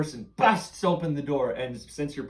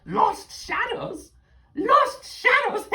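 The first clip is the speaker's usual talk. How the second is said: high, loud, slow delighted witch; 9 dB louder, slower than usual. Acted. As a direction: savour the words like a hag. Witch reacts to news.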